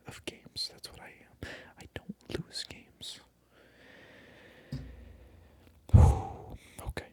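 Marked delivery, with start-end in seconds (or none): 3.8-6.8 long sigh. Acted. Exasperated pause in whisper rant.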